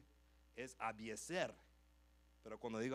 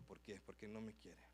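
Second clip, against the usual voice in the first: sad inner thoughts; 7 semitones lower, 9 dB quieter than usual. Retold or imitated.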